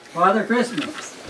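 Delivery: ordinary delivery, nothing marked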